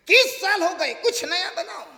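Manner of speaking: loud mock demanding husband; mimicking husband. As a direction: whine it like a fussy husband. (पति की नकल में ऊँची माँग)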